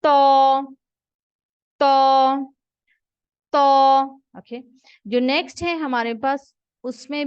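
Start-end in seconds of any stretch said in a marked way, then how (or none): 0.0-4.0 "तो" loud, higher and heavy; teacher contrasts the heavy "तो"; heavy letter demo. Shown as a demonstration. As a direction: hit a full heavy "तो". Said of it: "ये मोटे हरूफ में से है".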